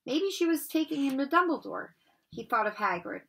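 usual speaking voice

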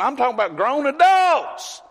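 high, rising incredulous exclamation. Retold or imitated. Preacher retells friend's shock at churchgoers, incredulous. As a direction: climb to a loud, astonished finish. Retold friend's astonished complaint.

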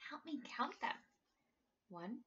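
ordinary delivery, nothing marked

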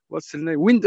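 ordinary delivery

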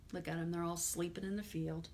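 plain reading voice

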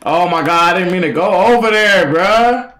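loud, high outburst climbing at the end; frustrated yell. Real, not acted. Streamer who didn't mean to go there, yelling. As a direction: yell in dismay at your own mistake. Streamer upset at own mistake.

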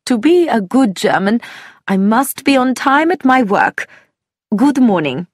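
a hint of a German character, politely formal; lightly voiced German man.

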